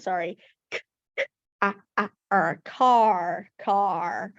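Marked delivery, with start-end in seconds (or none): 0.7-2.6 "K, k" whispered as a bare k sound, then "ah, ah, er". Shown as a demonstration. Teacher sounding out car one sound at a time. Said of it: "can you sound it out?".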